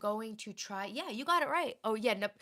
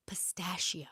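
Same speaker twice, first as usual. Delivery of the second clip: whispered; 4 semitones lower, 6 dB quieter, slower than usual. Shown as a demonstration. Coaxing viewers to say it, whispered. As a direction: whisper it softly, breathy and unvoiced. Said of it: "Say it right now".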